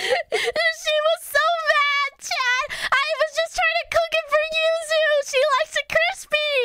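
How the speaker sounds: very high, squealed through laughter; laughing hard. Real, not acted. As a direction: protest helplessly while cracking up. Helpless laughter retelling the scolding.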